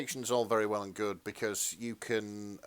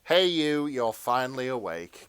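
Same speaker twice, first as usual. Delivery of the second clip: voice from the fanfic; 3 semitones higher, 8 dB louder than usual. Acted. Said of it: "came a voice".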